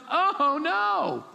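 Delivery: high, alarmed cry of a frightened servant; panicked servant. Acted. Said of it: "his servant looks out and goes".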